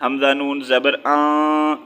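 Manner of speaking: آن held as a nasal hum; ikhfa with ghunna. Shown as a demonstration. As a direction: hide the noon of آن in a drawn-out nasal sound. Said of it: "اخفا کریں گے". (آن میں اخفا اور غنہ)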